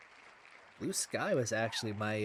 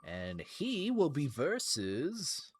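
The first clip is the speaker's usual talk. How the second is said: slow, raised build-up to a reveal; suspenseful announcer; 4 semitones higher, slower than usual. Real, not acted. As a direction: draw it out before the opponent's name. Building up to the opponent's name.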